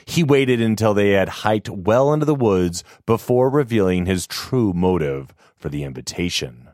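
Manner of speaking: usual speaking voice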